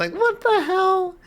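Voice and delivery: squeaky high disbelief; sky-high astonished squeak; stunned fan in disbelief; amazed. Real, not acted. Overwhelmed by trailer.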